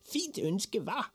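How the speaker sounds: high, pleased little voice; boastful boy. A narrator's reading. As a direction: pipe it up, fishing for praise. Boy boasts of his wish.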